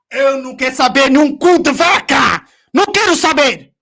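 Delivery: very loud, full-force outburst; real rage, bellowed at the club; furious shouting. Real, not acted. Refuses excuses at peak of rant.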